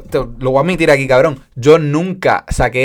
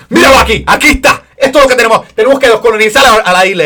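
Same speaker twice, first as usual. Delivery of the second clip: much higher, louder preaching rant; impassioned shouting teacher; 8 semitones higher, 6 dB louder, faster than usual. Retold or imitated. Spanish teacher ranting about the colony, high and loud. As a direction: yell it like a fired-up patriot on a rant. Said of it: "te gritaba... apasionadamente".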